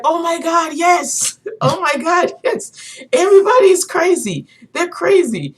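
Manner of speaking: very high, loud worked-up retelling; hammed-up high-pitched rant; imitating passionate ranter. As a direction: act out an excited man's outburst. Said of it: "he was so passionate about what he was talking about".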